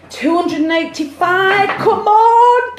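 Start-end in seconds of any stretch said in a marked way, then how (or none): none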